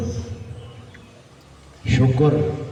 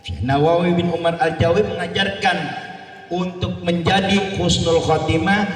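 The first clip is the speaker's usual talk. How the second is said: ordinary talk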